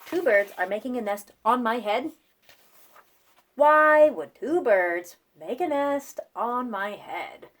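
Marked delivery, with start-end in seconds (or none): 3.6-7.5 loud, slowed exasperated question; dismayed wail. Acted. Gerald complaining to Piggy, dismayed wail. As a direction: drag it out in dismay.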